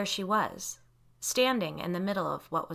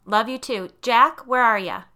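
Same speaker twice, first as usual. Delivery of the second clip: loud, raised shout through the house; projected, higher call; 5 semitones higher, 12 dB louder than usual. A narrator's reading. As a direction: holler back, then call for someone.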